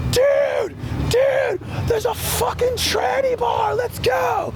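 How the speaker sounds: a friend shouting a wild discovery; excited yelling friend. Retold or imitated. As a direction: blurt it out like breathless big news. Friends run up with news.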